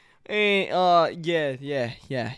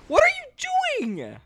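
very high, slow, drawn-out question; incredulous squeal; slower than usual. Real, not acted. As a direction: squeal in disbelief at your friend. Baffled by teammate's antics.

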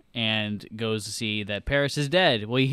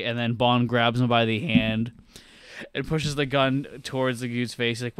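normal talking voice

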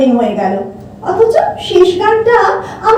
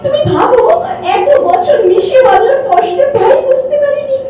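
anguished self-blame; 8 semitones higher than usual. Acted. Woman blaming herself for missing Anu's suffering, anguished. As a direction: lament it in disbelief, voice raised.

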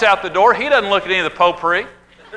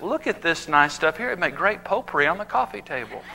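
soft, gushing mock-delicate voice; dainty Daniel Boone; 5 dB quieter than usual. Daniel Boone gushing over field flowers, softly. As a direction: coo over the flowers like a decorator. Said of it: "Daniel Boone stops and just says".

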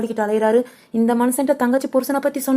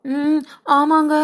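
drawn-out whine; 3 semitones higher, much slower than usual. Acted. Wife acts hurt to husband.

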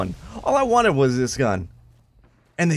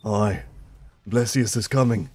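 put-on low Scottish-or-northern accent; mock Scottish accent; 7 semitones lower, 5 dB quieter than usual. Gamer parodying Jon Snow, low mock Scottish accent. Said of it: "Scottish or Jon Snow".

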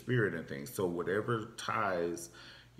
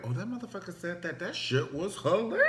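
a touch of delayed realization; 10 semitones higher than usual. Retold or imitated. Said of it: "you be like".

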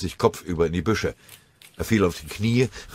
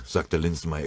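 ordinary delivery

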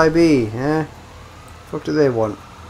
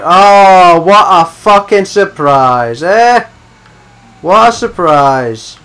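sarcastic; 3 semitones higher, 9 dB louder, much slower than usual. Real, not acted. Sarcastic at enemy spawn.

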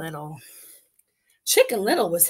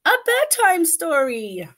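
put-on high eager child voice; chirpy little girl asking papa; little chicken voice; 8 semitones higher, 4 dB louder, slower than usual. Chicken asks for story.

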